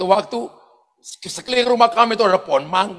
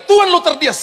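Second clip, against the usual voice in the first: raised final-call announcement; retold boarding call; airport announcer; 8 semitones higher, 4 dB louder than usual. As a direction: page a late passenger, higher pitched. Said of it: "Panggilan ketiga".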